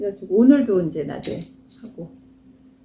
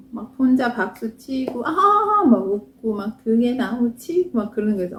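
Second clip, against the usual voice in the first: ordinary talk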